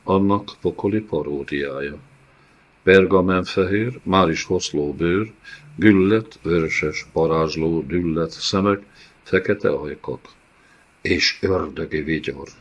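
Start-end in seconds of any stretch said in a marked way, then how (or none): none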